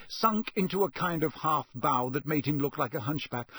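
plain reading voice

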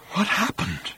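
hushed and high with awe; awed whisper. A narrator's reading. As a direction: breathe it out in wonder. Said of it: "whispered Arthur in considerable awe".

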